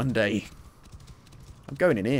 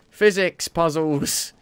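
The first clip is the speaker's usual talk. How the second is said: slow, loud, higher delight; excited; 5 semitones higher, 6 dB louder, much slower than usual. Real, not acted. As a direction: cheer the discovery. Excited at puzzles.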